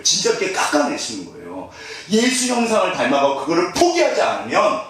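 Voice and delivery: normal talking voice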